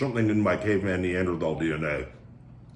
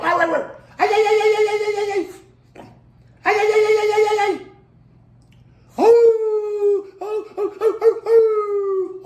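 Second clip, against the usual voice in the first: very high, loud "ay ay ay" breaking into laughs; a yodelling falsetto cry that cracks into laughter; laughing war cry; 7 dB louder than usual. Pretend.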